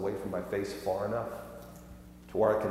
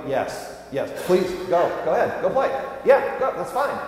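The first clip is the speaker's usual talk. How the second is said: quick interjections climbing higher and louder; imitated impatient dad; 7 semitones higher, 4 dB louder than usual. Dad trying to interrupt his pleading kids, increasingly high and insistent. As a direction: keep trying to cut in with consent, rising each time. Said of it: "the whole time I'm going".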